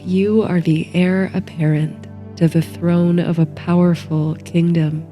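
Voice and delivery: usual speaking voice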